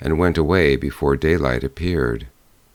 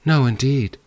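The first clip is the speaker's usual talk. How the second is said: put-on higher, earnest young girl; eager girl's promise; 7 semitones higher than usual. A narrator's reading. Girl promising the bear, high and earnest.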